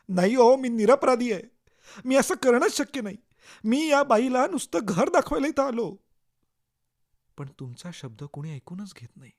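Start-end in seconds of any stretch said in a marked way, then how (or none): 0.1-6.0 high, loud frantic plea; pleading shout. Acted. Accused man pleading with the police, loud and frantic. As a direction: beg them to believe you, at full voice.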